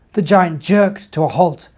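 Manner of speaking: gentle storybook narration; reading to children. A narrator's reading. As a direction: read it like a bedtime story.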